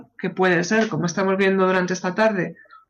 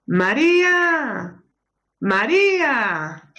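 slow, drawn-out calls of her name, higher and higher; repeated calling; 9 semitones higher, much slower than usual. Acted. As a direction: keep calling her as you walk closer. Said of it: "acércate por el pasillo llamándola".

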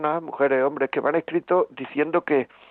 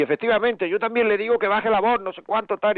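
agreeing wife; 7 semitones higher than usual. Retold or imitated. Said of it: "en vez de darle la razón y decir".

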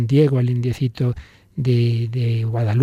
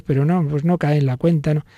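ordinary talk

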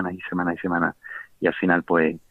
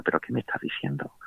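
stunned whisper; 9 dB quieter than usual. Retold or imitated. Salió del confesionario con las manos en la cabeza.

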